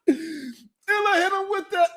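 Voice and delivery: slow, high, laughing till near tears; gasping words between laughs. Real, not acted. Host losing it laughing about the big head call.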